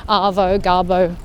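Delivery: "Arvo" and "Garbo" said with a broad Aussie -o ending. Shown as a demonstration. A vlogger demonstrates "Arvo" and "Garbo" to her viewers, broad Aussie -o endings. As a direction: lean into the final "o" of "Arvo" and "Garbo". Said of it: "put O's in front of everything".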